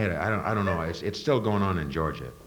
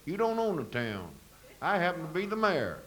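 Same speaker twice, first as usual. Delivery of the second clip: mayor; 11 semitones higher, 5 dB quieter than usual. Acted. Mayor answers him.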